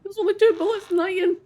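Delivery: high, loud panicked shout; alarmed yell. Real, not acted. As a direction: yell a warning mid-fight. Panicked during game shootout.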